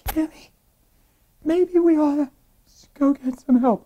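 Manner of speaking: high, halting, about to give in; hesitant near-surrender. Retold or imitated. Husband about to ask his wife for help, hesitantly. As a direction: start reluctantly in a small high voice. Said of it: "I was ready to say".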